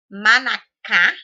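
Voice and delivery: Manacá slow and loud, CÁ punched; stress on last syllable. Shown as a demonstration. Teacher giving an oxytone example, stress on CÁ in Manacá. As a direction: stretch and hit the final -cá of Manacá. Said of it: "o cum é mais forte".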